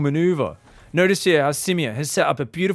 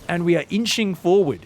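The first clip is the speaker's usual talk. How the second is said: ordinary talk, nothing marked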